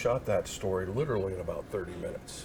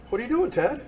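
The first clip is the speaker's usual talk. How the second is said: imitating the president; 14 semitones higher, 6 dB louder than usual. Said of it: "And the president goes".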